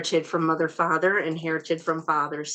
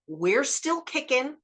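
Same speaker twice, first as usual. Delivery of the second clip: ordinary delivery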